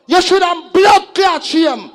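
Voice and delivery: loud, high, slow and hammered; furious shout. Real, not acted. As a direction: spit the insult at the rival. MC cursing rival sound.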